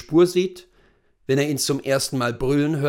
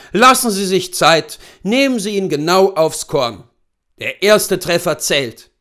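higher, louder voice for the seasoned hunter; brief the client as an old hunting guide; erfahrener Großwildjäger; 5 semitones higher, 8 dB louder than usual. Acted. Hunter instructing his client. (erfahrener Jagdführer, lauter und höher)

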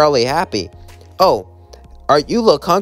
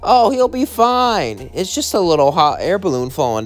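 breezy reassurance; 5 semitones higher than usual. Acted. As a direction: wave it off with cheery, lilting pitch. SpongeBob breezily dismisses the crash.

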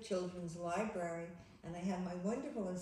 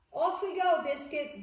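loud, higher cheerful read; excited storytelling; 6 semitones higher, 8 dB louder than usual. A narrator's reading. Librarian reading to children, loud and cheerful. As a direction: call it out like setting off on an adventure.

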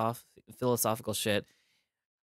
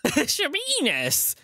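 excited; 14 semitones higher than usual. Real, not acted. Exclaiming the silly word.

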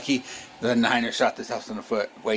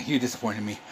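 usual speaking voice

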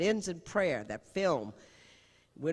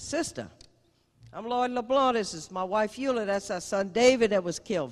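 higher, louder Cajun voice of Lloyd; higher friendly Cajun greeting to the nun; Cajun man; 6 semitones higher, 5 dB louder than usual. Retold or imitated. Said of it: "Very Cajun, very".

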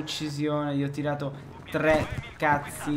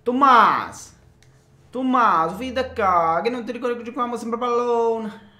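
calling out; 8 semitones higher, 5 dB louder than usual. Acted. Calling out in a put-on voice.

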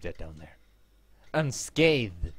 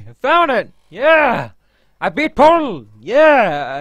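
loud triumphant yell; excited cheering; 11 semitones higher, 13 dB louder, slower than usual. Real, not acted. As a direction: celebrate the win. Celebrating winning.

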